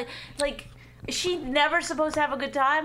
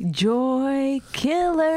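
slow sing-song jeer; drawn-out taunt; much slower than usual. Real, not acted. Wife heckling the husband, drawn-out taunt. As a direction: hold each word long, teasing.

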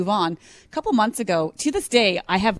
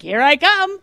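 higher, louder playful shout; playful chase call; 9 semitones higher, 6 dB louder, slower than usual. Retold or imitated. Mother chasing her son in a game, playful shout. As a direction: call out as you start the chase. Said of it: "And I'm like".

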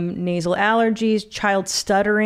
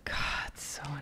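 whispering; 17 dB quieter, much faster than usual. Real, not acted. Aside remark, unvoiced.

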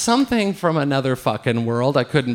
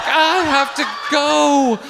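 high, drawn-out wail of desperation; comic plea to escape; desperate wail; 9 semitones higher, slower than usual. Retold or imitated. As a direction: stretch GO out slow and high, hammed-up. Acting desperation to leave.

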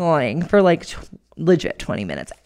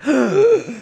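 gasping; 8 semitones higher than usual. Retold or imitated. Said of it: "I am so out of breath".

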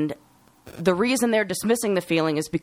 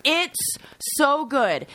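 drawn-out enthusiasm; 10 semitones higher, much slower than usual. Real, not acted. Echoing praise of the show.

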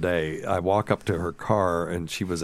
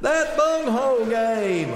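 very high, slow, loud repeat; innocent mother-in-law; 4 dB louder, slower than usual. Retold or imitated. Mother-in-law repeating herself on the beach, high and slow. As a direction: say it again proudly, clueless. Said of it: "And she goes".

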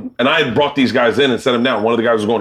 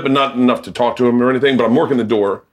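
ordinary delivery

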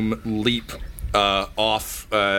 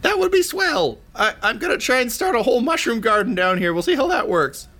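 much higher, eager little creature voice; high-voiced poop smith; faster than usual. Poop smith handing over his treasured mushroom, high-voiced.

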